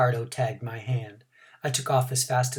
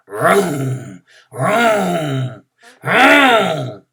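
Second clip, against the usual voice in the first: louder race car vroom; race car noises; 3 semitones higher, 8 dB louder, much slower than usual. Acted. Ricardo making race car noises, building louder. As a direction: rev it like a car engine. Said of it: "noises like a race car".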